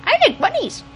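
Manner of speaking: very high, squeaky character voice; Gurr impression. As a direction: squeal it out in a tiny cartoon voice. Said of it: "That's my Gurr impression".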